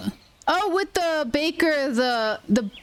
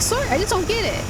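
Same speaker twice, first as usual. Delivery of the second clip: higher-pitched Minnesotan apology; Minnesotan accent; 8 semitones higher than usual. Retold or imitated. Apologising to a baffled coworker, Minnesotan accent. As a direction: apologise in a high Minnesotan lilt. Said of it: "I don't usually use that accent at work".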